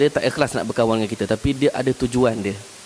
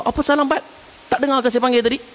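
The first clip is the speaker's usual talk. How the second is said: much higher, indignant rebuke; angry scolding; 12 semitones higher, faster than usual. Retold or imitated. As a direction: scold the one who came late. Zorniger Vorwurf.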